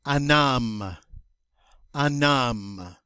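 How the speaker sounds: "Un homme" with silent h, un linked without nasal; teacher modeling "Un homme", silent h; model French word. Shown as a demonstration. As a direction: say "Un homme" as one linked sound. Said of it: "so it's going to sound like un homme".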